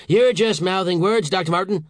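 high, loud interruption; irritated boss; angry snap. A narrator's reading. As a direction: cut him off impatiently. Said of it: "snapped the Secretary".

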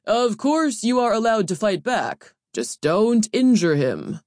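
loud, exasperated overseer; harried official, slowing for emphasis at the end; hassled. A narrator's reading. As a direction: raise your voice, fed up with the questions. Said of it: "The training overseer sounded hassled".

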